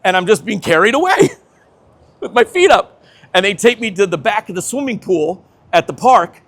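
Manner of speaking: normal talking voice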